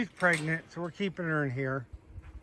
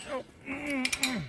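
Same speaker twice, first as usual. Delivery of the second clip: dismayed squeak; 11 semitones higher, 3 dB quieter, slower than usual. Real, not acted. Reacting to goat mischief.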